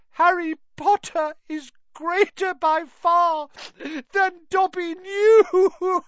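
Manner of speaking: very high, loud, sobbing elf; tearful high-pitched elf; Dobby sobbing. A narrator's reading. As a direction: wail it, dissolving into sobs at the end. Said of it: "he sobbed".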